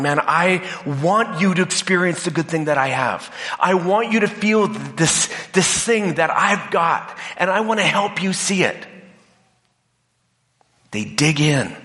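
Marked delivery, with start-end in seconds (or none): 0.0-8.9 much higher, pleading, enthusiastic; well-meaning evangelist voice; earnest convert. Retold or imitated. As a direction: press your good news on them. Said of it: "They're going".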